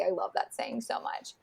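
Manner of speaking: whispered aside, barely voiced. Real, not acted. Whispering to her co-hosts about a favourite line. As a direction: breathe it like a secret.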